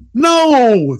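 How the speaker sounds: high-pitched outraged "No!" of a customer; mock scream. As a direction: imitate a festival-goer yelling in protest. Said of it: "they're screaming in, in Northern Indiana dialect".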